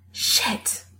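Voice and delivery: breathy unvoiced whisper; whispered curse. A narrator's reading. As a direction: whisper it in panic. Panicked realization, swearing.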